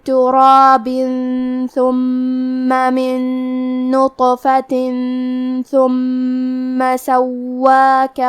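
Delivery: very slow, melodic tajweed recitation; Quran recitation. Acted. Teacher recites the full verses for students in tajweed. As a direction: chant the verses slowly and reverently.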